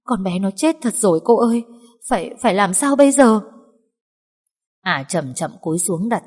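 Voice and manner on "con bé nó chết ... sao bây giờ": higher, louder panicked plea; begging for what to do; the maid panics to her mistress, frightened and tearful; frightened pleading. Acted. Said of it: "Linh khóc lóc sợ hãi".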